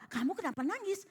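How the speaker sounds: lightly acted father asking in return; retold question thrown back. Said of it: "Dia colek juga".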